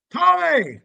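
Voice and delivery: a high, loud call of Tommy. Retold or imitated. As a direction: yell the name like summoning from the yard.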